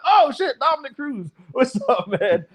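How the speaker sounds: suddenly thrilled, a laugh breaking in; recognizing a hero in the crowd; excited recognition. Retold or imitated. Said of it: "I looked at".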